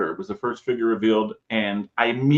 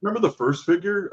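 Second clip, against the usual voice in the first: mock teasing reveal; 9 semitones higher than usual. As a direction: needle him like you've been saving it. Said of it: "They're like".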